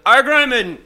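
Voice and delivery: high, loud slurred outburst; sudden drunk yell. Retold or imitated. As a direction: blurt it out loud and high, half-awake. Drunk man bolts up shouting.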